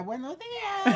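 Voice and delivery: high squeaky cartoon Tweety voice; cutesy Tweety greeting. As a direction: chirp it like the cartoon bird. Voicing a Tweety greeting image. (saluda con vocecita chillona de Piolín)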